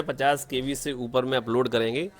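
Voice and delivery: normal talking voice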